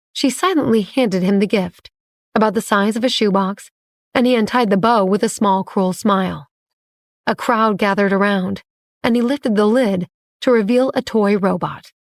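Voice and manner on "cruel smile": ordinary delivery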